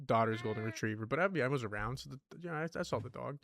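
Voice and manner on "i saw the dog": usual speaking voice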